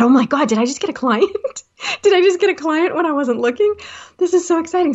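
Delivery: high, fast, breathless with excitement, laughing; thrilled inner voice. Retold or imitated. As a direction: squeal inside at landing a client. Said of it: "I was so excited".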